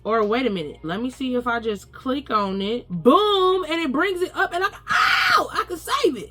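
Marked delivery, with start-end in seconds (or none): 2.9-6.3 very high, excited yelp; thrilled squeal. Real, not acted. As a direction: squeal with delight. Thrilled a click saves the map.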